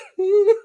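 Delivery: high, loud put-on wail; mock sob. Vlogger re-enacting her reaction to a letter, mock sob. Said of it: "I just had me in tears".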